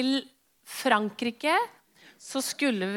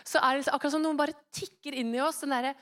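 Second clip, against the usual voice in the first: usual speaking voice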